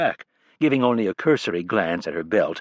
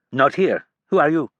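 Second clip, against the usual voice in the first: put-on cold French-accented assistant, louder and higher; thick French accent; 6 semitones higher, 5 dB louder than usual. A narrator's reading. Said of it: "in a thick French or French-Canadian accent".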